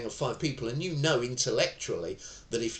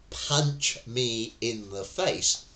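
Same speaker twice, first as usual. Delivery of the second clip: slow echo of the threat; much slower than usual. Shown as a demonstration. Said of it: "The minute you go".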